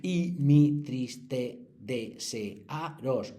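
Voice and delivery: very slow, syllable by syllable; counting syllables. Shown as a demonstration. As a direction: tap out each syllable of "siempre crece mi serviros". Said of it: "Si contáis las sílabas". (silabeando muy despacio "siempre crece mi serviros")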